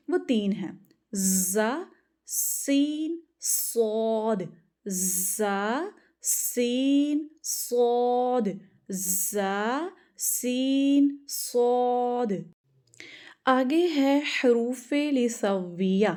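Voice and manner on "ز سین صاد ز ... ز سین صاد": ز، سین، صاد drawn out with a long hissing whistle on the s and z; whistling letters demo. Shown as a demonstration. Teacher showing students the whistle in the sibilant letters. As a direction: let the ز، سین، صاد hiss like a whistle, slow. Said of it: "کن حروف کے اندر سیٹی نکالنی ہے آپ کو".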